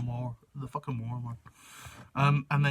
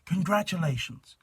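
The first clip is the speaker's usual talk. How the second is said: English voice; 4 semitones higher than usual. Acted. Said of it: "in an English voice".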